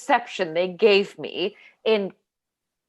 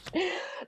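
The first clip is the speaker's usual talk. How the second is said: short laugh; 11 semitones higher, 9 dB quieter than usual. Acted. Laugh while recalling the old set.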